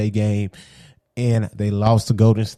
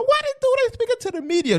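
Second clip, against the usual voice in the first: mocking angry fans; faster than usual. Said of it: "And everybody's mad".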